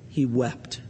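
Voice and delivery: low, soft and grave; a quiet, heavy close; hushed solemn reading. Real, not acted. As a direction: let the voice drop right down. Repeats the solemn verse ending.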